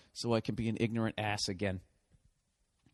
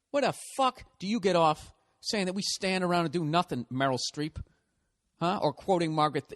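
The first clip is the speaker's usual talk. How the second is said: ordinary delivery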